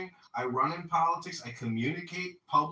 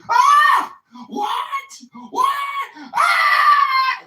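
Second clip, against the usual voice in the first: shrieking much higher and louder; panicked scream; 16 dB louder, much slower than usual. Retold or imitated. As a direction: scream in shock at the news. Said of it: "And everybody was like".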